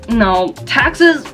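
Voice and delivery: ordinary delivery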